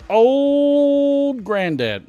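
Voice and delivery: very slow and high, stretched out; drawn-out announcement. Acted. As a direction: stretch the name out like a showman. Drawn out brand name.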